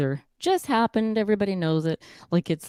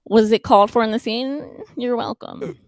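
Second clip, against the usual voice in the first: sassy mock gratitude; 7 semitones higher, 6 dB louder than usual. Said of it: "I'd be like".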